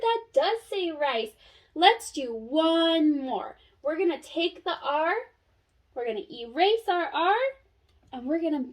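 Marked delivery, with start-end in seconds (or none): none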